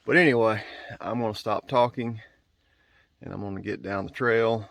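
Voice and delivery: normal talking voice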